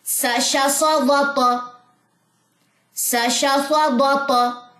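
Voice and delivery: slow, sing-song syllables; rhythmic reading. Shown as a demonstration. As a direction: read it in one steady rhythm. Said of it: "एक line में पढ़ते हैं".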